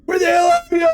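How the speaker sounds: high-pitched, loud panicked call; frantic shouting. Retold or imitated. Boyfriend bursts out looking for Claire, frantic shout. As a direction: yell out for her at the top of the voice.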